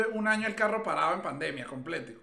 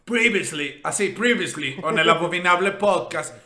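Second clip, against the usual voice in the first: recap announcer; 6 dB louder than usual. Pretend. Recapping an earlier podcast episode, loud mock announcer. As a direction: punch it like a series recap.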